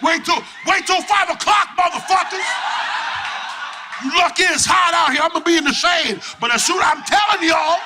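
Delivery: mock yell. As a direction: shout it up high like a threat.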